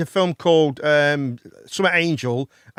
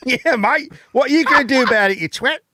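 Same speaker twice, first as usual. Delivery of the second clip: put-on rowdy Australian accent; 4 semitones higher than usual. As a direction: play a cocky Australian bloke picking a fight.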